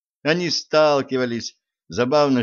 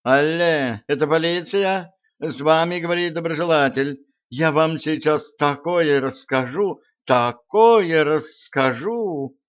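higher, slow sly informant voice; fake informant on phone; 5 semitones higher than usual. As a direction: play the sly anonymous caller. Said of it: "Сплинтер включил связь".

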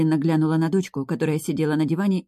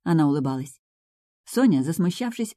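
plain reading voice

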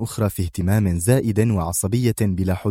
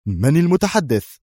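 sharp demand; 6 semitones higher than usual. A narrator's reading. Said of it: "فالتقطه بحركة حادة قائلًا".

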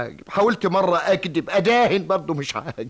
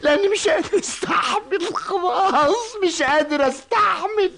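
high, drawn-out crying wail; tearful breakdown; slower than usual. Acted. Worn-out employee breaking down before his boss, crying. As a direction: break into sobbing, stretching the words. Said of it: "أنت بتعيط؟".

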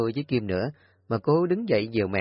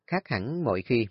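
plain reading voice

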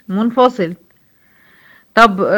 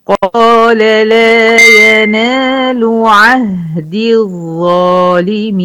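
slow, drawn-out melodic tajweed chant; Quran recitation; 6 dB louder, much slower than usual. Acted. Teacher reciting the verse to her students, slow melodic chant. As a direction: chant each verse slowly with long held vowels.

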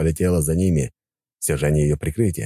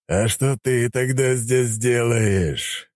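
mocking monster voice; 6 semitones higher, much slower than usual. Dragon answers Vasilisa mockingly.

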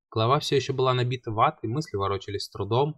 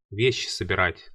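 usual speaking voice

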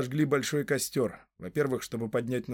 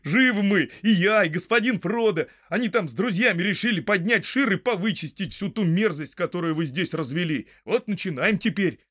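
high, loud, eager announcement; elated returning hobbit; 6 semitones higher, 5 dB louder than usual. A narrator's reading. Hobbit telling farmer the uprising begins, elated. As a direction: share the news with rousing energy.

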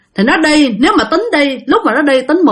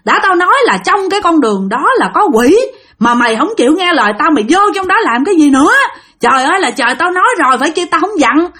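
higher-pitched, exasperated telling-off; angry scolding; 6 semitones higher than usual. Acted. Aunt scolds the woman for ignoring warnings, angry. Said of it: "Bà Sáu bà chửi rằng".

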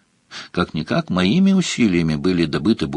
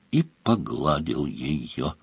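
ordinary delivery

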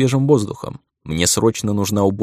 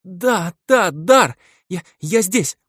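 much higher, tumbling words; flustered rapid babbling; 10 semitones higher, much slower than usual. Acted. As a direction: babble it out in a rush of relief. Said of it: "сбивчиво затараторила девушка". (сбивчивая взволнованная скороговорка)

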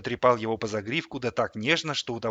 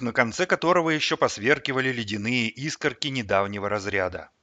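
ordinary delivery

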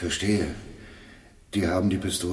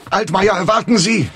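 calling out; 6 dB louder than usual. Acted. Calling after Altmaier as he leaves.

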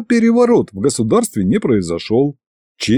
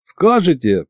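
higher-pitched, eager prompt; lightly raised voice offering a hint; helpful prompting; 6 semitones higher, slower than usual. A narrator's reading. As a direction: chime in with a suggestion. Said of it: "подсказал я".

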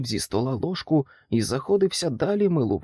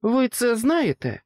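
high-pitched anxious question; desperate cry; 10 semitones higher, 4 dB louder than usual. A narrator's reading. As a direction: press desperately for the answer. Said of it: "розпачливо вигукнув Джек". (розпачливе запитання високим голосом)

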